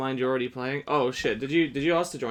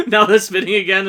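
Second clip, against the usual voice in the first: laughing; 7 semitones higher, 13 dB louder than usual. Real, not acted. Laughing at spinning clock hands.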